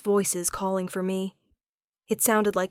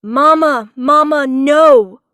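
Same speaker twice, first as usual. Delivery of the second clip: put-on high, much louder child's scream; 7 semitones higher, 15 dB louder, slower than usual. A narrator's reading. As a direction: cry out for mother in panic. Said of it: "screaming for me".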